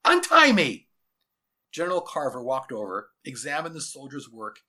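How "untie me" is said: high, loud demand; desperate high yell. A narrator's reading. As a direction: shout it like a captive boy fighting his ropes. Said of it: "yelled Jason Rasmussen".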